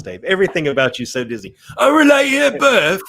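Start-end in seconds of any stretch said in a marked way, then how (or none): 1.8-3.0 put-on loud, slow, high-pitched Mary Poppins English accent; mock English accent. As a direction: belt it out in a hammed-up English accent. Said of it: "English accent is obviously inspired by Mary Poppins".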